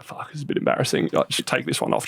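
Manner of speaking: hushed, breathy aside; a whispered private admission; embarrassed whisper. Retold or imitated. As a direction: whisper it like you're ashamed. Embarrassed after-party confession.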